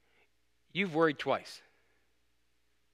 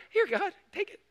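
lightly high, airy offering; a hint of naive cheer; mock breezy surrender. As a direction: hand it over with fake ease. Mimics naive handing over.

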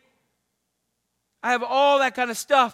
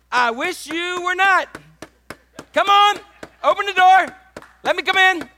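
loud, high, insistent call through the door; hammed-up Jesus pleading to be let in; pleading knocker; 9 semitones higher, 8 dB louder than usual.

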